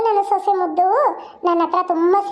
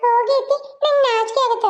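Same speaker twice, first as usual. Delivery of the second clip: higher mock coy voice; mother-in-law mimics the bride's coy protest, mocking and higher; mocking imitation; 5 semitones higher than usual. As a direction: parrot her shyness back with a sneer. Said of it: "ಅಂತೆ".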